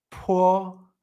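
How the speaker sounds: "Po" with a puff of air before the vowel; aspirated p. Shown as a demonstration. Teacher leading learners to repeat, aspirated demonstration. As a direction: blow out on the p of "Po", vowel level. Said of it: "¿Puedes repetir conmigo?".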